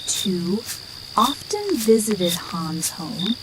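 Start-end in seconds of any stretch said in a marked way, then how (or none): none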